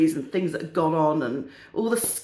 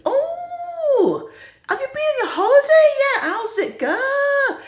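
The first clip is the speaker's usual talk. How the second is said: put-on high-pitched northern old lady; hammed-up northern English gossip, voice way up; northern English accent; 5 dB louder, slower than usual. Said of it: "have a go at the accents".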